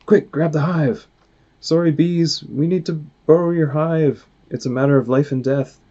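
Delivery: grabbing the hive from bees, urgent and breathless; urgent character voice. Acted. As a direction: hurry everyone, apologise on the run. Character urging escape with hive.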